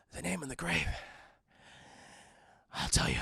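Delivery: low, soft dying-man voice; hushed, faltering mock deathbed voice; feeble murmur. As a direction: act too weak to speak up. Dying Blondie reveals the secret.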